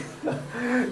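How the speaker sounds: high-pitched giggle breaking out; burst of laughter. Real, not acted. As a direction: laugh in the middle of the story. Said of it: "I'm already laughing thinking about it".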